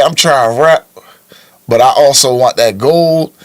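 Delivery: ordinary talk, nothing marked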